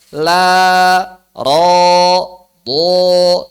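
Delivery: la thin, ro and do heavy and rounded; ro and do said thick, la kept thin; thin and thick letters. Shown as a demonstration. Teacher drills thin and thick sounds for students. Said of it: "Beda suaranya".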